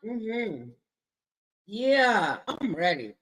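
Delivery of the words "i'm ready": laughing. Real, not acted. Laughing reply.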